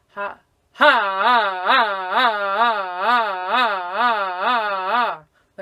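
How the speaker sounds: loud pulsing ah waves like an ambulance; siren-like pulsing ah. Shown as a demonstration. Teacher demonstrates loud diaphragm pulses on ah. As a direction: push loud even waves of ah. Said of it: "I really sound like an ambulance".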